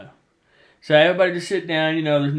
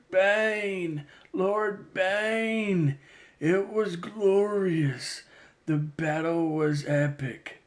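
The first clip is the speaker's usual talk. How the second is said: high, soft, slow voice of a dying man; dying gasp; 4 semitones higher, 5 dB quieter, much slower than usual. Retold or imitated. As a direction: speak through your last breath, slowly. Dying Sith reports to Bane.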